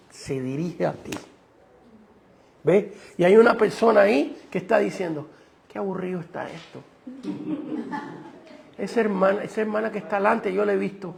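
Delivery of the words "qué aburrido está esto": bored aside. Retold or imitated. Oyente aburrido en el culto.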